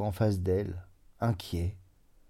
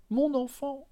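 second, higher repeat of mon enfant; rising stammer. A narrator's reading. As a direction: push mon enfant up in desperation. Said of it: "Elle balbutia".

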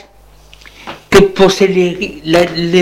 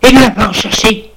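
high snapping demand; 6 semitones higher than usual. Acted. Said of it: "Elle se lève d'un bond".

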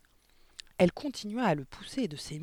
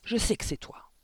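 loud accusing cry; 6 semitones higher, 5 dB louder than usual. A narrator's reading. Headmistress accusing Mathilda, loud angry. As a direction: point the finger with your voice. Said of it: "vociféra-t-elle".

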